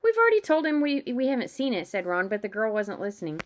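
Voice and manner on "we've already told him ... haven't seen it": higher, louder protest; acted exasperated retort. A narrator's reading. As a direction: insist you've said this already. Acted irritated reply to the girl.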